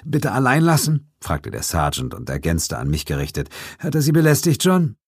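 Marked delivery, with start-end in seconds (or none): 0.0-1.1 put-on high, hesitant young policeman; a nervous young cop, pitched up; young constable voice. A narrator's reading. 3.8-5.0 put-on high, hesitant young policeman; a nervous young cop, pitched up; young constable voice. A narrator's reading.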